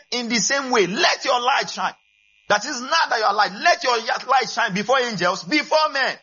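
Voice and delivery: ringing preacher's shout; shouted climax. Acted. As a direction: shout it to the whole church. Sermon climax, quoting Jesus.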